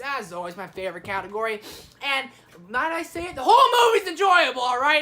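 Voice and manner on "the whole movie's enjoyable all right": loud, high burst of enthusiasm; emphatic shout. Real, not acted. Reviewer insisting to viewers, emphatic enthusiastic shout. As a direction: shout your verdict with conviction.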